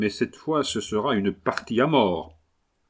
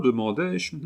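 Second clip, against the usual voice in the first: plain reading voice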